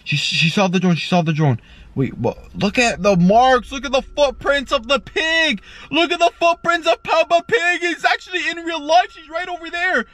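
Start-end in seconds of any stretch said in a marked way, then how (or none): 2.5-10.1 high, escalating excited exclamation; excited discovery. Pretend. Vlogger showing viewers Peppa Pig's footprints, excited. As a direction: exclaim with rising, breathless-pitched amazement.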